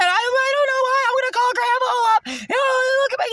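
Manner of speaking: high, shrill-pitched mock of a panicking mother; squeaky mock of a hysterical mom; mimicking screaming mom. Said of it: "she started screaming again".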